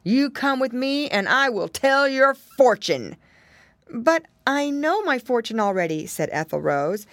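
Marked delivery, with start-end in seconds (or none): 0.0-3.2 loud, higher-pitched storybook villain bear; triumphant bear voice; gloating bear. A narrator's reading. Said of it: "the bear cried". 3.9-6.1 higher, lightly voiced worried girl; a touch of tearful protest; near-tearful girl. A narrator's reading. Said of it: "she was just ready to cry again".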